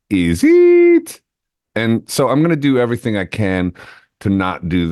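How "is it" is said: very high, loud squeaky London "is it?"; exaggerated Cockney squeal; mock English "is it". Host showing off his English impression, high and loud. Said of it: "aping the English accent".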